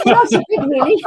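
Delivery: giggling as you imitate the locals; a chuckled, hammed-up quip; laughing mock quote. Mock Scots reaction, laughing.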